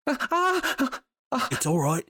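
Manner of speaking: high, very slow soothing. Acted. Corbin calming shrieking Lulu, high and very slow. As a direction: stretch it out high to calm her.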